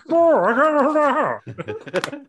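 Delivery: hammed-up fat voice, very high; Cheeks. Shown as a demonstration. Demonstrating how stuck Cheeks sounds, high slow fat-voiced wail. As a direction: stretch it into a long, loud, slurred wail. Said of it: "he's got, like, a fat voice".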